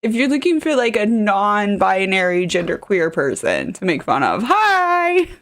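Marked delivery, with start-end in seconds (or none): 4.4-5.3 high, drawn-out comic hello; mock greeting. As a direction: sing out a playful high-pitched greeting. Offering self as target, jokingly.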